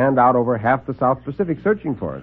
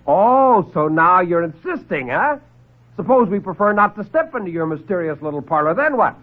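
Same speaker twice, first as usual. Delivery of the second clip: high, needling sarcasm; sarcastic defiance; 7 semitones higher than usual. Acted. Lieutenant taunts the doctor who insists, sarcastic. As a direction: dare him to try it.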